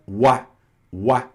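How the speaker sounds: "uá" said slowly as a "wa" glide; vowel sound demo. Shown as a demonstration. Teacher explains O plus I to Brazilians, vowel sound demo. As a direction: stretch "uá" as one isolated sound. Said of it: "o O mais I vi".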